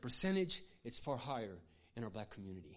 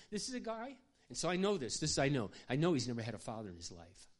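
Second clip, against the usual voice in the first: ordinary delivery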